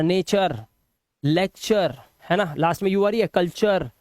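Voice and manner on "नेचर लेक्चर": "नेचर, लेक्चर" ending in short -chər; नेचर, लेक्चर as nay-chər, lek-chər; correct "अर" ending. Shown as a demonstration. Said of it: "last में अर से पढ़ना है".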